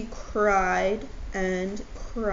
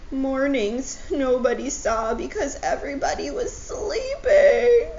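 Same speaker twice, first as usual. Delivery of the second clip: loud, higher mock wail; hammed-up sobbing; 6 semitones higher, 6 dB louder than usual. Owl sobs over sad mornings, hammed-up loud wail. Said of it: "sobbed Owl".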